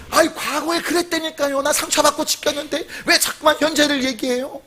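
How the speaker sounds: high-pitched mock grumbling; put-on plaintive complaining; whiny protest. Voices an objecting listener dwelling on hurt.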